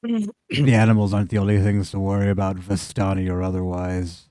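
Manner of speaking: low, subdued farmer voice; simple farmer, warning. Acted. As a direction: caution the strangers gravely.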